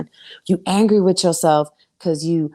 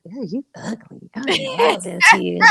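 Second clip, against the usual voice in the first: playful revulsion, pitched up; mock disgust; 5 semitones higher than usual. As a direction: recoil comically from an old flame. Recoiling from an ex, retold.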